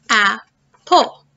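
"apple" in two detached beats; split into syllables. Shown as a demonstration. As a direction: pause between both syllables of "apple". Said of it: "which rhythm has two syllables".

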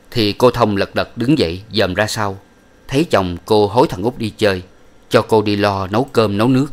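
ordinary delivery, nothing marked

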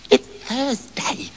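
unvoiced, slow whisper. Shiny Boots whispers to Trousers behind the stove, hushed whisper. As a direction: breathe it so the robbers can't hear.

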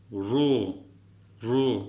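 "ru" with a tapped r and full u; ru sound. Shown as a demonstration. Teacher modelling heavy letter "ru" for students. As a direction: model a thick "ru". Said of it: "Bu da".

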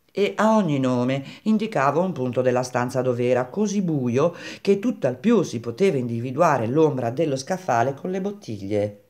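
usual speaking voice

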